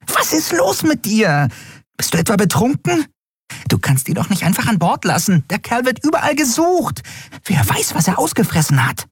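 high, tiny fairy voice, upset; a little winged friend scolding in alarm; agitated fairy. A narrator's reading. (aufgeregte kleine Fee, hoch)

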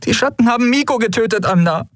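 high, upset outburst; emotional, fed-up protest; anguished plea. A narrator's reading. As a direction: plead with pain, voice pitched high. Endriel pleads, upset about killed friend.

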